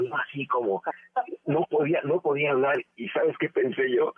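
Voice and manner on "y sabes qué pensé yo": usual speaking voice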